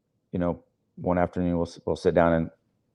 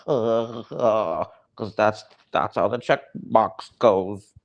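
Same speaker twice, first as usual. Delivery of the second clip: dim recruit; 3 semitones higher, much slower than usual. Retold or imitated. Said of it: "You get those people".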